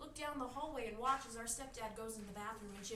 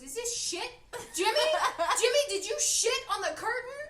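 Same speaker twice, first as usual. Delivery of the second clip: furious high yell at her brother; yelling sister; 10 semitones higher, 11 dB louder than usual. Retold or imitated. Sister yelling at Jimmy over the curtain, loud and high. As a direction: scream the accusation at Jimmy. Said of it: "And she goes".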